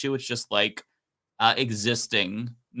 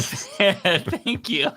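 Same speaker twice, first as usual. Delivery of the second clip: laughing thanks; 8 semitones higher than usual. Real, not acted. Thanking Wanda for a donation, laughing. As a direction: thank them through a laugh, pitched up.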